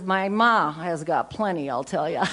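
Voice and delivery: put-on deadpan it like a cheeky teenager; teenage son. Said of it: "but he says".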